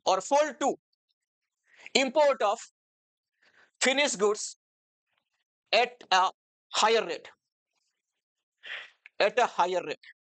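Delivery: word by word with long pauses; measured chunks, pausing to write; dictation. Real, not acted. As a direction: dictate notes for students to copy. Dictating notes while writing them out.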